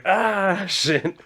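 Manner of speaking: high drawn-out "ah", then a curse; mock startled cry. Fighter jumped by a squirrel, mock-startled cry. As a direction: imitate being jumped, without shouting.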